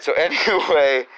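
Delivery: ordinary talk, nothing marked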